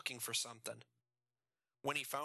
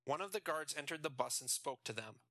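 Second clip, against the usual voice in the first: ordinary delivery, nothing marked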